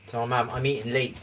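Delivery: ordinary talk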